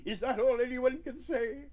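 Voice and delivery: high, loud wailing question; upset character's high-pitched plea; tearful protest. A narrator's reading. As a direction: protest it as if in tears. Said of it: "cried Nubbet, weeping large-sized tears".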